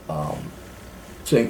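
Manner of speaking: ordinary talk, nothing marked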